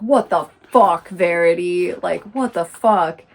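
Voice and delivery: loud, acted outraged disbelief; shocked anger. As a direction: imitate someone appalled, hitting it hard. Acted shocked confrontation in retelling.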